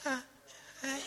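put-on tiny, timid child's hello; barely audible, bashful greeting; shy child. Daughter meeting a stranger, painfully shy. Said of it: "if my daughter acts shy".

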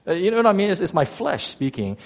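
normal talking voice

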